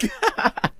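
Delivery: high, derisive laugh; mocking laugh. Husband scoffing at his wife's housework, mocking laugh.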